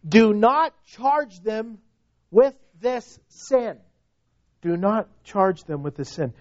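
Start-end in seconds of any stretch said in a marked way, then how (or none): 0.1-3.8 raised, slow, deliberate proclamation; loud cry. Acted. Preacher quoting Stephen's plea for his killers, slow raised cry. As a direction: call it out slowly, each word weighted. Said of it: "with a loud voice he cries out".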